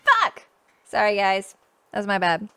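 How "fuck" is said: high, loud burst of swearing; frustrated curse. Real, not acted. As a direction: yelp it at losing progress. Game did not save.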